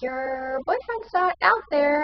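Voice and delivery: nurse voice. Retold or imitated. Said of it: "she's like".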